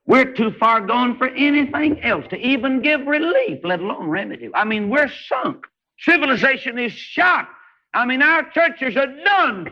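high, ringing preacher's cry; full-force revival preaching; fiery preaching. Acted. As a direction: preach it out to the back row.

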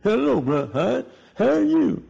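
high, halting, impaired speech; Urban Bell. Retold or imitated. Afflicted church member greeting his pastor, labored imitated speech. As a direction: imitate a man struggling to get words out. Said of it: "that's the way he talked".

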